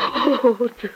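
a mother starting to break down over her daughter. Acted. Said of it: "you don't want to do that".

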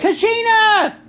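very high, loud call down the hall; yelling a name. Retold or imitated. Wife calling her sister at night, loud shout. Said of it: "And then she'll go".

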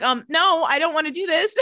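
high, mock whiny protest; whiny refusal. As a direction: pout it out, high and loud. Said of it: "Elena's like".

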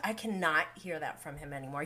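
ordinary talk

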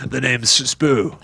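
slow, drawn-out villain intro; a hammed-up B-movie ghoul introducing himself; mock spooky character voice. Host playing a silly horror character, slow mock-menace.

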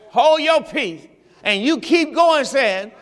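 ordinary talk

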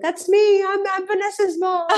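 high-pitched, beaming announcement; proud mother. Retold or imitated. Mother stands up mid-show to claim daughter, proud. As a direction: imitate a mom proudly claiming her fame. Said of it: "like, all proud".

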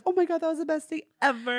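high-pitched dreamy gushing; mock swooning in a high voice; acted giddy elation. Acting Bridget elated after date.

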